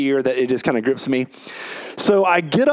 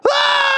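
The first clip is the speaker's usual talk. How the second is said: high-pitched yell of a jumper; a short, sustained high mock scream; imitated falling scream; 3 dB louder than usual. Jumper plunging past the waiting room, imitated high scream.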